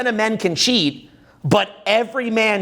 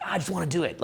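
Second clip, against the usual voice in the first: eager inner voice; 9 dB quieter, faster than usual. Retold or imitated. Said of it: "I'm just like".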